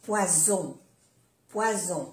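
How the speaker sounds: "poison" with a soft voiced z. Shown as a demonstration. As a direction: say French "poison" with a gentle buzzing z. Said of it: "una Z suave".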